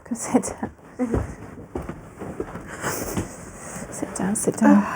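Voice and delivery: breathy whispered invitation to sit. Real, not acted. Therapist whispering to the tearful mother to sit down. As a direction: coax her gently into the chair, no voice.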